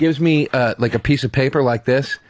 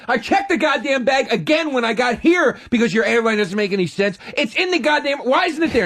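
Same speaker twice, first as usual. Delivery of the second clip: high-pitched exasperated rant; worked-up traveller griping; 9 semitones higher than usual. Retold or imitated. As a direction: complain in disbelief, voice riding high. Acting out his airport complaint.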